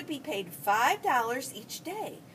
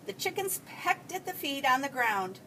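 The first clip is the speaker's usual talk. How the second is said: ordinary delivery, nothing marked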